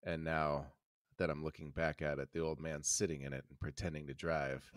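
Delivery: low, soft and subdued; mournful. Acted. Ash mourns his loved truck, low and soft. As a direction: mourn the truck under your breath.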